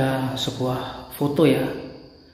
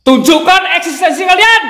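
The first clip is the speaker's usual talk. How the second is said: much higher, much louder call; challenging shout; 11 dB louder than usual. Real, not acted. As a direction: yell a dare into the house. Challenging ghosts to appear.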